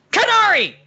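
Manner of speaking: loud, high shouted name; all caps shout. Shown as a demonstration. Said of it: "'cause it's all in caps".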